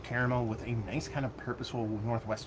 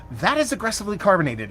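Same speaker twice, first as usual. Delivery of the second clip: excited; 8 semitones higher, 8 dB louder than usual. Real, not acted. Surprised by the beer's fizz.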